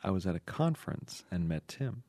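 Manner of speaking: low, hushed dream recollection; sexy dream voice. Acted. Letter writer recounting a dream, low dreamy voice. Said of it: "your sexy dream voice".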